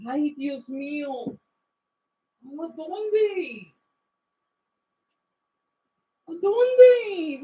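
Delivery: acted Mexican Spanish fretting, climbing in pitch; Mexican Spanish dialect. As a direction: Mexican Spanish, wail the drawn-out question while hunting for something. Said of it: "an example".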